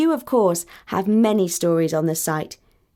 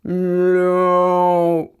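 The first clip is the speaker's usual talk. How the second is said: a short, louder mock meow; a mock meow for the bull, louder; cat meow; 2 semitones lower, 4 dB louder than usual. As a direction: meow like a cat to make a joke. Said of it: "The bull spoke like this".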